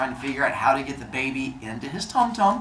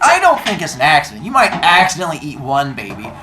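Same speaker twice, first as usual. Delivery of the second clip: loud, emphatic telling-off; acted indignant scolding at full volume; upset; 7 dB louder than usual. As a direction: play a fed-up wife laying out her case. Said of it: "So now Rhea is upset".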